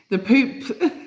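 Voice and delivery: "the Pope" with a laugh breaking through; fighting back laughter on "the Pope". Real, not acted. Vlogger can't say the Pope without laughing. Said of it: "I can't say it without laughing to myself".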